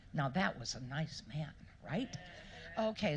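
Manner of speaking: ordinary talk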